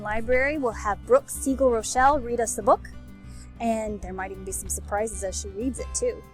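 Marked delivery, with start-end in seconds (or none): none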